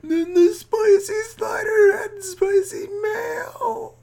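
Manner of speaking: shrill high-pitched squeal, loud. Acted. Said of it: "why is your voice going up so high".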